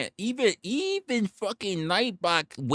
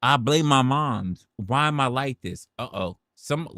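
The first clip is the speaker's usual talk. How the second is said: ordinary delivery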